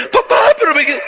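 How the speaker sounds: high, cut-off protest; a spoiled girl whining "Papá"; whiny daughter. Retold or imitated. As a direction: bride protesting to her father, high whine.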